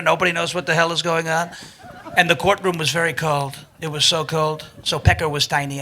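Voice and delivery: mock-solemn, low impression of a complaining politician; Donald Trump. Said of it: "You do this Trump voice".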